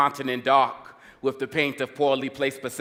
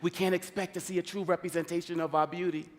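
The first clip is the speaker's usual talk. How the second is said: raised, driving rhythmic slam delivery; spoken-word poetry; 5 semitones higher, 3 dB louder than usual. Acted. Poet protests media images of Africa, driving spoken word. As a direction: punch the rhymes and keep the verse rolling. Performs rhyming poem on media.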